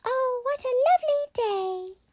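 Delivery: very high, squeaky toy voice; high-pitched toy. A narrator's reading. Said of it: "in its high-pitched voice".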